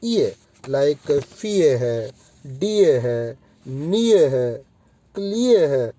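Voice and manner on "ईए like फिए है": "ईए" stretched slowly, then "फिए"; drawn-out ईए. Shown as a demonstration. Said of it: "इसको ईए बोलते हैं". (धीमे खिंचा "ईए")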